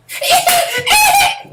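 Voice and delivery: loud high villain laugh; evil cackle. Pretend. EA exec cackling at angry fans.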